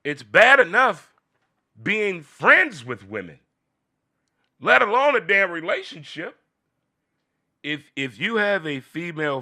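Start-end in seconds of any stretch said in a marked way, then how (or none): 0.0-6.4 emphatic rant. Real, not acted. Emphatic comic rant opening.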